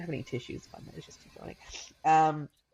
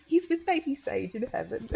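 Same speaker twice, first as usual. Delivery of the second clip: put-on child's voice; 6 semitones higher than usual. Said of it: "she goes".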